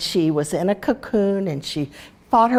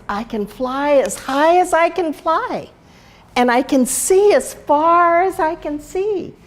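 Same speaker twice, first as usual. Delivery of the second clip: higher and louder, rising with excitement; eager pride; 8 semitones higher, 3 dB louder than usual. Acted. Flutterby telling the monarch what she can do, eager and higher. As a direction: announce it like a proud child. Flutterby proudly lists her gifts.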